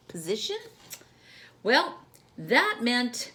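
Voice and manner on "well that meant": plain reading voice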